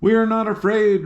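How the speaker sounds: high, firm defiance; a girl general refusing to back down; determined. Acted. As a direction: stand firm and declare it. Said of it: "she looked so determined".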